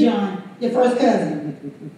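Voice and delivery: calm low reassurance as Jesus; Jesus telling his cousin John he is the Messiah, low and soft; Jesus answering. Retold or imitated. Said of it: "send the message to him and say".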